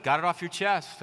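normal talking voice

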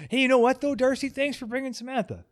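pitched high, playful thanks to Darcy. Real, not acted. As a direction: lift it up high, teasing and bright. Teasing thanks, laughing.

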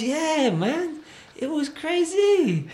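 very high, loud, slow yeah man; awed friend. Retold or imitated. French friend recalling the O2 party, very high and drawn out. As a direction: stretch out the crazy like you're still reeling.

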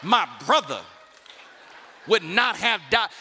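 loud, reproachful sister's complaint; sister attitude. Retold or imitated. Martha scolding Jesus for coming too late, with attitude. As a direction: give Jesus some attitude. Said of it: "it's a sister attitude".

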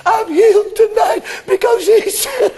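shouting through tears; ecstatic cry. Real, not acted. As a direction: proclaim healing near tears. Climax of testimony.

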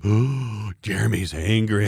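teasing, a touch shocked; mock shocked gasp. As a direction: gasp like the class did. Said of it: "everybody's like".

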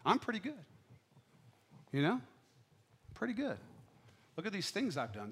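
smug Pharisee. Retold or imitated. Lightly voiced smug hypocrite. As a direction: pastor imitating the Pharisee's self-praise, a touch of mock smugness. Mocking the self-satisfied Pharisee.

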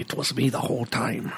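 quiet mock-Irish villain voice; Liam Neeson's Irish accent. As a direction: softly imitate an Irish movie villain's accent. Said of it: "I can't do the fucking accent".

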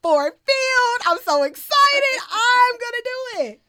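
building to a high, elated shout; confident excited announcement. Retold or imitated. Student announcing graduation after passing math, jubilant confident shouting. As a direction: proclaim it at full volume, triumphantly. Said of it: "I was able to confidently say".

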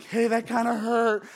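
hammed-up tearful complaint; crying yell. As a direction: yell it like a hurt, upset kid. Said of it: "crying, yelling, like".

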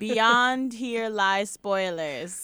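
slow grand proclamation; town crier. Pretend. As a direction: announce it like a herald. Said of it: "Hear ye, hear ye".

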